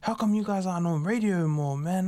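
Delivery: lightly voiced old Indian man raving about the song; Uber driver. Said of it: "nice old, uh, Indian guy from out west".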